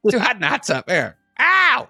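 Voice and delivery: high, whooping hot-tub impression; Eddie Murphy. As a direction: yelp it out in a high voice. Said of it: "That's Eddie Murphy in it".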